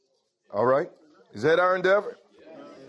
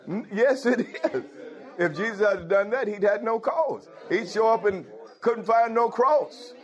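higher, rising preacher's fervor; preaching at full force; 7 semitones higher than usual. Acted. Preacher rousing the congregation about the cross, fervent. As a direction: lift it up, drive each line home like a sermon's peak.

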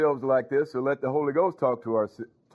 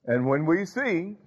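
slow emphatic preaching; slower than usual. Real, not acted.

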